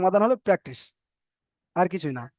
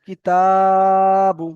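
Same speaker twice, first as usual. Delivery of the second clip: কিতাবু with তা held long and loud, বু short; short কি, long ringing তা, short বু in কিতাবু; elongated TA; 7 dB louder, much slower than usual. Shown as a demonstration. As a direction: stretch the middle of কিতাবু on one sustained pitch. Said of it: "টান দিয়ে পড়তে হবে".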